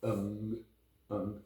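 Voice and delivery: "ung" hummed softly through the nose, fading; teacher drilling the ng sound. Shown as a demonstration. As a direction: hold the ng at the back. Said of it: "We have the ng sound like ung".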